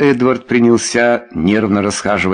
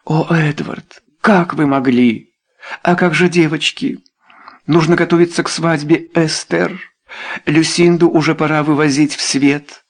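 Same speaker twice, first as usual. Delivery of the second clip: high-pitched, lamenting lady; distressed wife; 7 semitones higher than usual. A narrator's reading. Wife fearing for her daughters, distressed. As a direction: reproach him in rising alarm.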